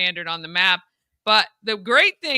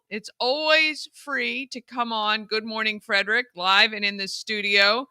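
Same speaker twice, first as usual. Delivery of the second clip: normal talking voice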